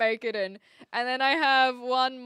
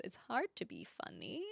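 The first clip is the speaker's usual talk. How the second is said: whispered aside; 2 semitones lower, 12 dB quieter than usual. Real, not acted. Whispered aside about comedians.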